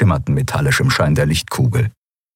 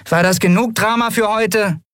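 much higher-pitched little character voice; scolding Nelen. Acted. Nelen cutting short the drama, high-pitched scolding. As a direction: scold them in a high, small voice.

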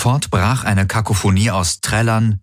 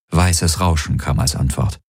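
ordinary delivery, nothing marked

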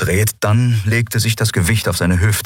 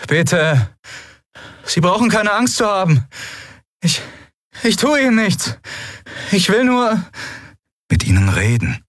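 high, gentle pleading voice; pleading young man; 12 semitones higher than usual. Acted. Kai pleads with unseen figure.

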